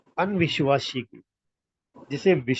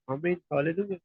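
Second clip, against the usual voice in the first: normal talking voice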